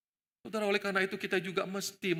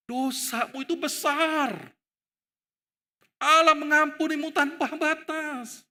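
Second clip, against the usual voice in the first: high, slow, ringing declaration; emphatic admonition; 7 semitones higher, 5 dB louder, slower than usual. Acted. Jesus telling Peter of God's mercy, slow ringing declaration. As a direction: proclaim it with drawn-out force.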